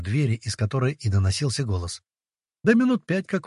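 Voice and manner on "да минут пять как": ordinary delivery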